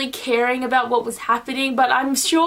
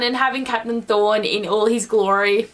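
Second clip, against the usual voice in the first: usual speaking voice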